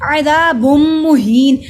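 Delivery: अज़ाबुम मुहीन with the m held and hummed; slow, loud recitation, joining the two words on a long m; long aa and ee, nasal hold on बुम; doubled meem. Shown as a demonstration. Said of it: "मीम पे शद है".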